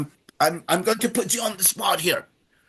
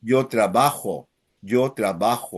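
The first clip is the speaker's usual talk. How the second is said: "trabajo" with the middle "ba" clearly stressed, said low; 7 semitones lower than usual. Shown as a demonstration. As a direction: lean on the middle syllable of "trabajo". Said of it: "but emphasizing the middle vowel".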